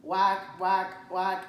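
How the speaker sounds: loud mock duck calls; hammed-up quacking; duck quacks. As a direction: honk it out like a duck. Duck quacks read aloud.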